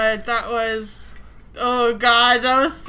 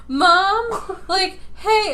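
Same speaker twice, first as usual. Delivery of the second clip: put-on higher voice of a child wanting attention; little kid; 7 semitones higher, much slower than usual. Little kid trying to get mom's attention, higher child voice. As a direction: imitate a small child pestering a parent. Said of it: "it's like little kids when they're like".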